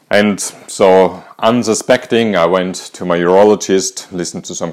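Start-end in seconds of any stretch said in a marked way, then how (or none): none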